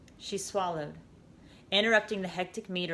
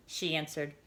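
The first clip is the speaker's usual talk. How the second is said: plain reading voice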